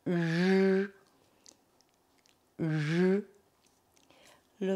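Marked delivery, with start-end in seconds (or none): 0.1-3.2 "j" as a long French j buzz, no i; drawn-out r sound. Shown as a demonstration. Teacher shows how R I is pronounced, "j" held long. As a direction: hold "j" as an isolated hum. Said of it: "Ça se prononce".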